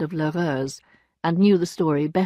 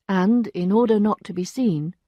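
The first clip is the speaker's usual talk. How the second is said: ordinary delivery, nothing marked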